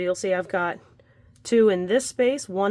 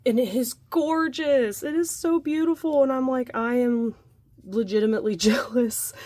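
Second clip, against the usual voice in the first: high, excited delight; gushing; 5 semitones higher than usual. Real, not acted. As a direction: rave about it, thrilled. Gushing over friend's blanket.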